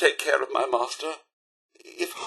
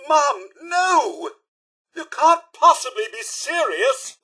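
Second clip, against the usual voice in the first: higher-pitched, appalled butler outburst; shocked protest; 9 semitones higher than usual. Acted. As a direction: protest in horrified disbelief, pitch raised. Butler protests employer's alarming decision.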